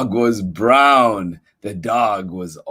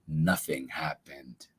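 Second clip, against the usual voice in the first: whisper; 8 semitones lower, 12 dB quieter than usual. Acted. Reader whispers a suspense line.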